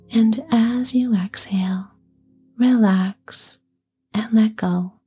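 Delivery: slow, whispered relaxation guidance; guided meditation. Acted. Leads a relaxation exercise.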